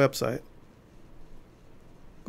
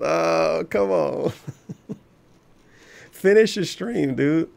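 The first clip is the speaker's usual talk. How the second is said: laughing in disbelief, slowly; 2 semitones higher, much slower than usual. Real, not acted. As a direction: laugh it out while scolding. Exasperated laugh at streamer.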